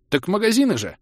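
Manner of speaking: high, surprised elf voice; puzzled at such an obvious question. Acted. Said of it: "удивлённо ответил ушастый".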